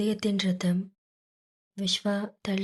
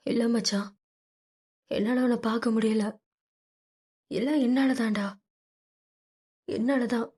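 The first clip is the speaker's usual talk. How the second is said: crying, self-blaming; 2 semitones higher than usual. Acted. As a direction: break down blaming yourself, pitch up. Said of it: "அவன் மார்பில் முகம் புதைத்து அழுதான்". (குற்ற உணர்வுடன் லேசாக அழும் குரல்)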